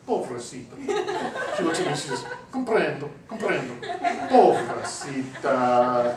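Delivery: sympathetic waitress voice through laughter; pitying waitress, laughing. Retold or imitated. Waitress to the wife, pitying through laughter. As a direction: coo with pity while laughing. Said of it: "pats her on the hand and says".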